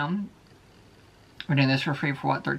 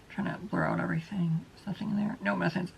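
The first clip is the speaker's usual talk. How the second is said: whisper; 11 dB quieter than usual. Real, not acted. Quietly blurring items on camera.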